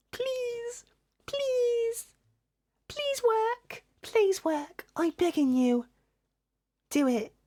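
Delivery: squeaky high begging at the screen; desperate high pleading. Real, not acted. Streamer begging her broken air server, high squeaky pleading.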